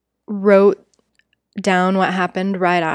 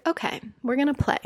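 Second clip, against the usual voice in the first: quick, higher, playful invite; lightly voiced excited therapist, quick and higher; mock excited; 5 semitones higher, 5 dB quieter, faster than usual. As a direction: imitate her eager glint. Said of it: "look in her eye, like she's excited".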